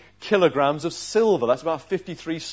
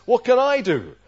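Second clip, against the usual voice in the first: the king of Israel; 9 semitones higher, 4 dB louder than usual. Retold or imitated. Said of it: "he says".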